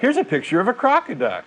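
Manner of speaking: high, silly voice of a creationist holding up a picture; mock creationist. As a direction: sell it like a proud child at show-and-tell.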